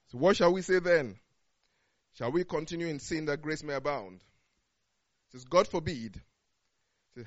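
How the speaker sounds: measured, solemn Bible reading; scripture reading. Acted. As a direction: read the verses out with weight. Reads Romans chapter six aloud.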